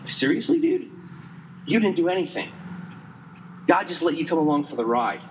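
high, disbelieving "seriously, dude?"; mock disbelief at a bragging pastor; incredulous scoff. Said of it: "And I'm like".

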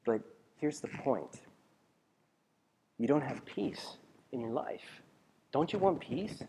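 slow, soft and earnest; quiet sincerity. Real, not acted. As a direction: lean in and speak gently. Quiet intimate point.

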